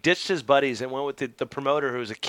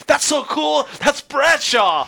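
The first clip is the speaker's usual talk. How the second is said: excited; 12 semitones higher, 5 dB louder, slower than usual. Retold or imitated. Excited onlookers quoted in story.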